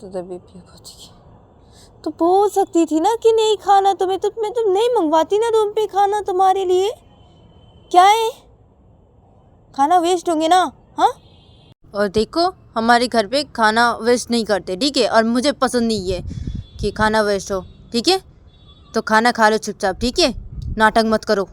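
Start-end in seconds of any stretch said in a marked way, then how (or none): 2.0-11.1 very loud, high angry outburst; husband yelling. Acted. Husband blowing up at wife over food, yelling. 11.9-21.5 loud, firm telling-off; stern husband. Acted. Husband forbidding wasted food at home, loud and stern.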